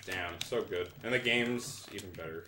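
ordinary delivery

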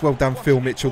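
usual speaking voice

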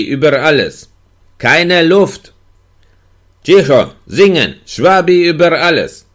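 höher und lauter hin und her gerufen; wütend gegen die Tür anrufen; laute Rufe. A narrator's reading. Gefangener und Wärter schreien hin und her. (Streit durch die Tür, laut und hoch gerufen)

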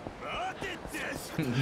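soft, high snickering; quiet giggle at your own silly joke; stifled laugh. Real, not acted. Amused by own silly joke name.

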